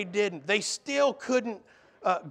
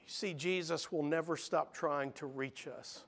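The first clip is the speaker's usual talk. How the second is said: ordinary talk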